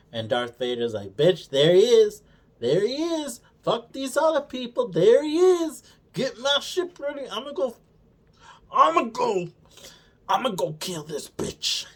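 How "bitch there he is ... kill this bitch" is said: high, loud excited villain; hyped-up Vader. Retold or imitated. Vader spotting Obi-Wan and ordering his ship, high loud excitement. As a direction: shriek like a villain who found his prey.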